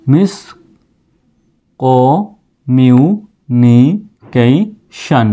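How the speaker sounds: Communication broken into slow separate syllables; teacher slowly building up communication. Shown as a demonstration. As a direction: sound out communication piece by piece. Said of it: "সম্পূর্ণ উচ্চারণটা এরকম আসতেছে".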